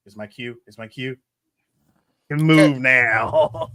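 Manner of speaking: low hushed doll voice, slowing down; low creepy whisper. Retold or imitated. Chucky voiced coming alive.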